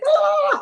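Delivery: sudden loud high cry, scattered mind; frazzled mind cry. Retold or imitated. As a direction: blurt a frantic 'Ah' like a racing mind. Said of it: "when my mind is like".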